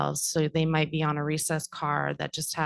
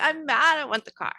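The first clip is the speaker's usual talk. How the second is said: high, loud mock child's whine; a lightly acted upset five-year-old; mad kindergartner; 10 semitones higher, 5 dB louder than usual. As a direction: play the cross little kid who wants the toy. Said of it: "they'd probably be like".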